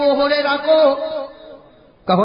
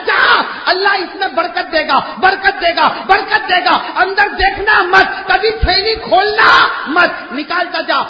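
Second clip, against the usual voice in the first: proclaiming; 5 semitones higher, 5 dB louder, slower than usual. Acted. Prophet's blessing proclaimed by preacher.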